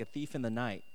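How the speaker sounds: usual speaking voice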